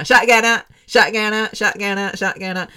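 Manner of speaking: high street-slang shout-out, repeated and easing off; street lingo. Retold or imitated. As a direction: start loud, then let the slang chant settle. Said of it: "street kind of lingo".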